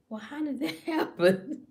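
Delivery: high-pitched question with a laugh; mock bewilderment. Mock-innocent reaction to pregnancy news.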